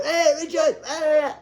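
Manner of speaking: high shouted greeting across the street; high happy shout of hello; calling out. Retold or imitated. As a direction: call out loud and cheery. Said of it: "he'd always go".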